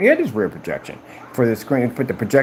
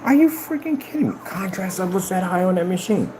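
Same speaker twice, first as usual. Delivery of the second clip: much higher, incredulous outburst; shocked disbelief; 9 semitones higher than usual. Real, not acted. Seeing the contrast numbers, a high shocked outburst. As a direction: yelp it in disbelief.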